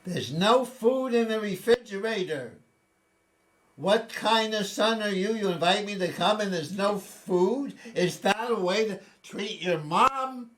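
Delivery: high, loud outraged complaint; guilt-trip the son, loud and high; indignant mother. Retold or imitated. Mother complaining to her son about the empty fridge, high loud indignation. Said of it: "and says".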